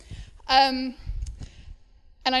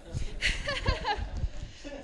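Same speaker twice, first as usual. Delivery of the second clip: laugh; 10 semitones higher, 4 dB quieter than usual. Real, not acted. Shy laugh after struggling for words.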